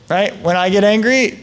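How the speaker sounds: ordinary talk, nothing marked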